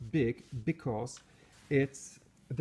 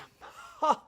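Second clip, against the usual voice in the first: short high-pitched laugh; burst of laughter; 14 semitones higher, 4 dB louder than usual. Real, not acted. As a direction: laugh out at your own quote. Laughs after quoting own video.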